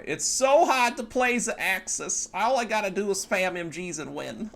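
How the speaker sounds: put-on high-pitched German-accented sarcasm, slow; hammed-up complaint in a German accent; mock German complaint. Streamer mocking easy Axis play, high German accent.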